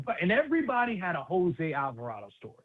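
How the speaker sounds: usual speaking voice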